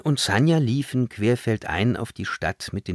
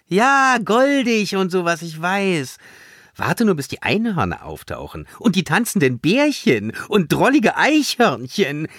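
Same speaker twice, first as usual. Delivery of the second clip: high, mock-gushing complaint; a touch of sneering at all things cute; mildly sarcastic disgust; 10 semitones higher, 4 dB louder than usual. A narrator's reading. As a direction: cut in with weary mockery. Sarcastic girl cuts him off.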